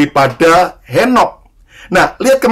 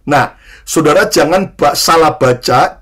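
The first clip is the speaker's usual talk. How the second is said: usual speaking voice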